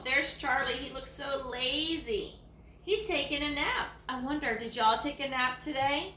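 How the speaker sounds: ordinary delivery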